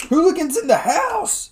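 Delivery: high excited shout; hype shout. Real, not acted. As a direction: shout it out to the crowd. Hyping the show's crowd.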